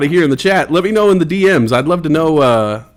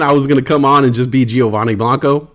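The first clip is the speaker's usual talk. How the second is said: ordinary talk, nothing marked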